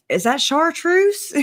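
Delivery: high, slow Minnesota Fargo officer; Fargo accent. Retold or imitated. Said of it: "Fargo police officer who was like".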